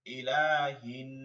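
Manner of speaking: ilahin said slowly with a held ghunna on the n; ইলাহিন with a long nasal hum on the n; nasal hold. Shown as a demonstration. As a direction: stretch the nun in ইলাহিন through the nose. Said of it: "ওয়াজিব গুণা করে পড়তে হবে".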